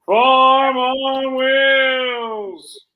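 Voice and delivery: very high, sustained holler; long, loud, slow high cry; drawn-out shout. Pretend. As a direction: belt it out and hold every word. Co-host shouts along after title.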